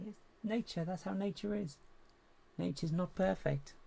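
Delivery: high, playful mock pronouncement; acted quote.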